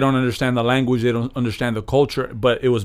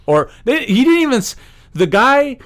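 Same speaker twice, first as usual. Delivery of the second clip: much higher, worked-up correction; heated rush; 11 semitones higher, 4 dB louder than usual. Real, not acted. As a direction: push the point, getting worked up. Heated point about Goya CEO.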